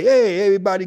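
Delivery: announcing a meetup to everybody, called out loud; calling out. Retold or imitated. Calling people out to lake.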